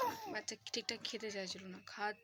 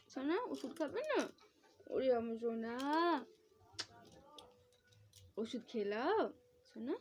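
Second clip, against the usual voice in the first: gentle rising sing-song; 3 semitones higher than usual. Real, not acted. Mother coaxing her son to take medicine: rising sing-song.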